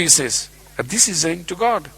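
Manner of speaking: usual speaking voice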